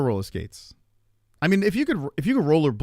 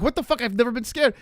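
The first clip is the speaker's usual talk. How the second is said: shocked outburst; 9 semitones higher than usual. Retold or imitated. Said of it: "I'm like".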